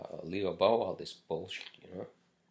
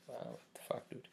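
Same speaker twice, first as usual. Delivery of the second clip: disbelieving whisper; 12 dB quieter than usual. Real, not acted. Muttering in disbelief at rude client.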